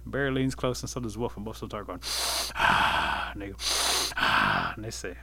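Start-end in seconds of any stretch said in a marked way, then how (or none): 2.6-3.3 long noisy sniff, then a voiceless "ah"; loud sniff and exhale. A narrator's reading. Bear and Wolf smell Rabbit up close, sniffing loudly. 4.2-4.7 long noisy sniff, then a voiceless "ah"; loud sniff and exhale. A narrator's reading. Bear and Wolf smell Rabbit up close, sniffing loudly.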